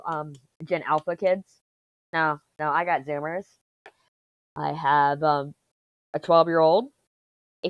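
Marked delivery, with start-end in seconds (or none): none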